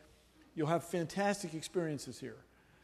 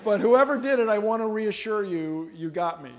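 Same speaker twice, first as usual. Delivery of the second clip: ordinary delivery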